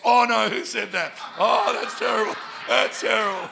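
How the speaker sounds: loud hammed-up shock; mock outrage. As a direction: exclaim like a scandalised listener, for laughs. Mimics an offended listener.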